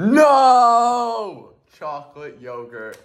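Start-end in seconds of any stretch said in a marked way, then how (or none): 0.0-1.5 high, loud cry of no; shouted no. Real, not acted. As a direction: yell no in protest. Reacting to chocolate yogurt.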